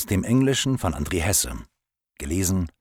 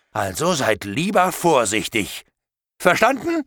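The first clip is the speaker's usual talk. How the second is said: higher, louder menacing rant; angry threat; 10 semitones higher, 4 dB louder than usual. Acted. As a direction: rage at the captives and scare them. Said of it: "da wurde ich noch wütender als vorher". (lauter, wütender, drohender Ausbruch)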